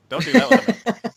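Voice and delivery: panicked high-pitched yell; shrill shouted warning. Retold or imitated. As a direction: scream the warning in caps. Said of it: "in all caps".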